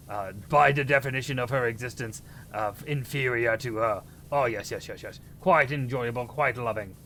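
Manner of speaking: hammed-up mock-British voice; put-on British accent. Streamer grumbling about cleaning the tower, mock British accent. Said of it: "British voice for four minutes".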